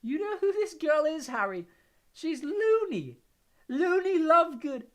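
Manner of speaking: high, slow, giddy voice breaking into laughter; giggling weakly. A narrator's reading. Ron giggles to Harry about Luna. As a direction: giggle into his ear, loopy. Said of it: "still giggling weakly".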